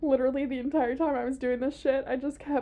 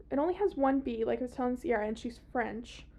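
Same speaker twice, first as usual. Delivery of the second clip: ordinary talk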